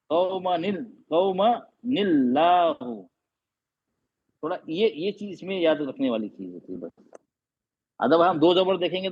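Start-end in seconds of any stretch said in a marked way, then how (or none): 1.1-3.0 कौमनिल्लाहु slowly drawn out; model recitation. Shown as a demonstration. Teacher shows how the verse is read. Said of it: "आयत को इस तरह पढ़ेंगे".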